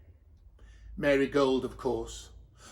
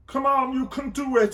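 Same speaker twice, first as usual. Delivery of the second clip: higher, coaxing voice of Joseph; 7 semitones higher than usual. A narrator's reading. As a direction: urge her on gently. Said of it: "said Joseph".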